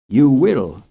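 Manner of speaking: firm and loud, no room for argument; a mother laying down the law, loud. Acted. Mother telling her son he will confess, firmly and loudly. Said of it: "she said firmly".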